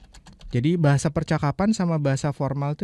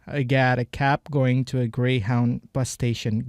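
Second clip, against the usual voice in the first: slow repeat; slower than usual. Shown as a demonstration. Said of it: "gotta itu juga bahasa percakapan".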